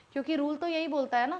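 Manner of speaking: ordinary delivery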